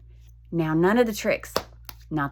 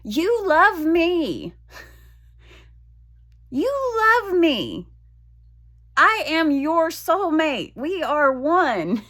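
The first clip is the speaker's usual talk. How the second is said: higher, slow, drawn-out pleading; mock pleading scold; 7 semitones higher, slower than usual. Talking down to her own body, slow pitched-up pleading. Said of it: "I was yelling at my vagina".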